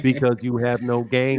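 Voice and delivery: low, even robotic monotone mimicking the caller; mock them in a deep one-tone robot voice. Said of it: "anybody that talks like this".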